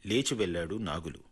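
ordinary delivery

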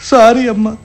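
weeping. Acted. Husband begs his mother's forgiveness, weeping. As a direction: sob between the words. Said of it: "ఏడుద్దాం".

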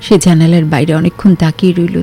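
ordinary delivery, nothing marked

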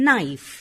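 "knife" said with no K sound; silent K. Shown as a demonstration. English teacher showing learners the word knife, silent K. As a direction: start "knife" straight on the N, K left silent. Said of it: "we do not pronounce the letter K".

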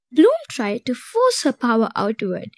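ordinary delivery

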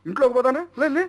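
high, stunned questions; shocked exclamations. Acted. Elder brother finds his friend tied up, asking in high shock. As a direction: recoil in disbelief at what you see.

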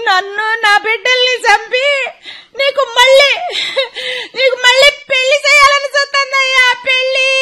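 lament-like crying; sing-song wailing. Acted. Wife wails to her husband about his mother's plot. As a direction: wail it like a dirge.

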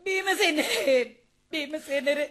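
tearful. Acted. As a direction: sob his name, then let it fade. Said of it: "உன் கண்ணீரை கண்டு". (அழுகையுடன் தழுதழுத்து)

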